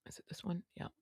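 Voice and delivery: unvoiced whispered question. Real, not acted. Vlogger asking her helper which button, whispered. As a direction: whisper it to the person beside you.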